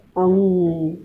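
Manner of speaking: अंग said through the nose, nasal ng; teacher demonstrating nasal अंग; nasal pronunciation. Shown as a demonstration. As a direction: sound अंग nasally as a model for kids. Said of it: "ऐसे नाक से उच्चारण किया जाता है".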